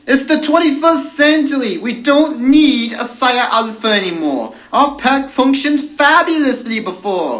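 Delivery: high, loud indignant wolf; howled protest. A narrator's reading. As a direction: howl your objection across the pack. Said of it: "one of the hooligans howled".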